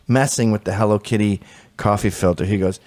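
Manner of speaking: ordinary delivery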